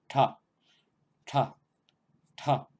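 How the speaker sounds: "Ta" with a curled tongue and a puff of breath; breathy retroflex "Ta" repeated; aspirated retroflex ta. Shown as a demonstration. Teacher demonstrating the aspirated retroflex for learners. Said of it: "es lo mismo, pero aspirado".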